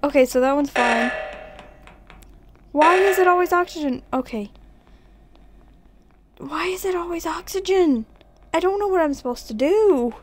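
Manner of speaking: high, loud exasperated whine. Real, not acted. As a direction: whine it in frustration. Repeated exasperated complaint.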